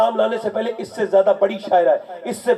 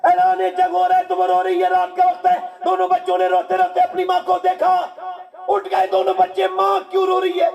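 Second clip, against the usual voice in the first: preaching at full force; 11 semitones higher than usual. Acted. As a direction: call it out to the crowd.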